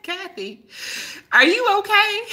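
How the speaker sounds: high-pitched mock concern; retold concerned check. Teacher checking on her zoned-out student, mock concerned. Retells checking on dazed Kathy.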